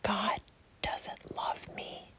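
faint, slow whisper. A narrator's reading. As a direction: barely breathe the words like a lie in the heart. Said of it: "whispering to every one of God's children".

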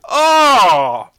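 loud pained groan of disgust; disgusted cry. Real, not acted. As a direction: cry out at a vile taste. Retching at the taste.

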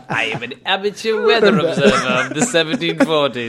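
laughing mock Kerry accent; playful Kerry country voice. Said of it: "put a Kerry accent on that".

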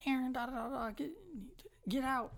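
breezy high voice brushing him off; mock teacher. Teacher sending him out of class, high and offhand. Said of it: "she was like".

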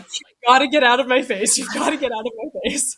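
high, loud mock protest; exasperated outburst. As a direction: act out yelling at someone crowding you. Retelling snapping at a close nurse.

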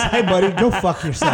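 retold insult, laughing through it; laughing quote. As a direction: laugh while delivering the punchline insult. Retelling a Phil Simms insult.

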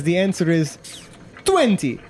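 high, confident warrior voice; hammed-up boastful contestant; Testicles. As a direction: announce the answer grandly, punching the last word. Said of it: "He says".

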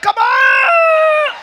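high, loud, drawn-out plea; exasperated yell, reenacted. Pastor reenacting trying to switch his phone off, exasperated yell. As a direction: yell it in comic desperation. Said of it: "holding that side button like".

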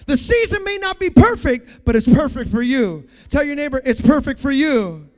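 loud, high, building sermon delivery; full-force preaching. Real, not acted. Preacher encouraging a friend through a hard season, full-force preaching. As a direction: build to a booming declaration.

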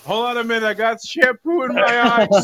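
shouting over the shower water; over running shower. Pretend. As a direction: call out from under a running shower. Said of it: "I heard the fucking water".

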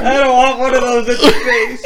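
laughing. Real, not acted. Repeating friend's line laughing.

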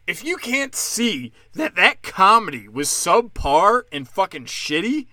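ordinary talk

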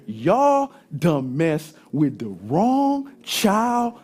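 hammed-up warning, word by word; indignant dad threat. Dad's threat to the boys.

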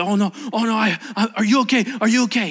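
loud, high, stammering alarm; acted panicked concern. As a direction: rush to an injured man in panic. Voices rich man's panic.

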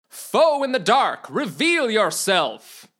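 loud, slow battle challenge; shout. Acted. Knight challenging a hidden foe, loud shout. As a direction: boom a challenge into the dark. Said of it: "he shouted".

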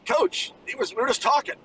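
flustered high answer to the pressing coach; nervous high reply. Retold or imitated.

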